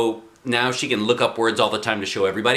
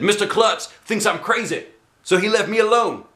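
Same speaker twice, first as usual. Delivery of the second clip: raised, eager karate teacher; 10 semitones higher than usual. Acted. Karate teacher lecturing kids, higher and brighter.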